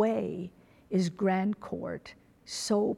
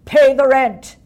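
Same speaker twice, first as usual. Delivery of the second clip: booming stage villain, much louder; mustache twirling villain; 3 semitones higher, 18 dB louder than usual. Pretend. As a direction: bellow it like a melodrama baddie. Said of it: "this childish parody of melodrama".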